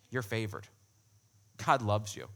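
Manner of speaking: low, soft reassurance after full preaching; tender assurance. Real, not acted. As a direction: drop low and quiet, let it reassure.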